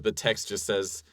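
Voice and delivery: ordinary delivery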